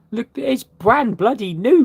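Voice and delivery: excited. Real, not acted. Showing off the machine.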